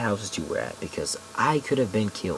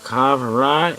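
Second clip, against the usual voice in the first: loud, slurred, questioning call; 6 semitones higher, 8 dB louder than usual. Retold or imitated. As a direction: call the name out like a drunk stranger. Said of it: "he's slurring his words, mumbling a bit".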